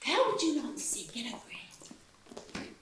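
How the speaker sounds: whispered, leaning in to tempt him; conspiratorial whisper. Acted. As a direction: breathe it like a forbidden secret. Secretive pitch to a gentleman.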